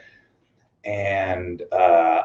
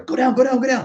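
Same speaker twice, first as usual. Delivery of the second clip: high urgent order. Retold or imitated. Voices the other wrestlers commanding.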